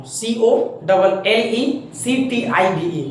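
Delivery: slow letter-by-letter spelling. Shown as a demonstration. Teacher spelling collective noun slowly for students. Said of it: "इसलिए मैं धीरे धीरे बोल रहा हूँ".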